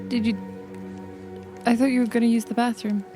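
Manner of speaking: usual speaking voice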